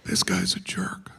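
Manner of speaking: hushed unvoiced aside; whispered aside. Real, not acted. Comic whispering an aside about a heckler. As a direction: drop to a whisper, as if not meant for them. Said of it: "whispered aside".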